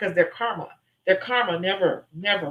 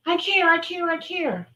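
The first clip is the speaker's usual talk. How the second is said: high, earnest gush; hammed-up heartfelt voice; mock caring; 8 semitones higher than usual. Shown as a demonstration. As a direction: pour on the caring. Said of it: "But I can go from".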